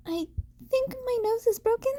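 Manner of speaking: put-on high, fast boyish voice; high young voice. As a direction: rush it out in a high young hero's voice. Izuku voiced in dialogue.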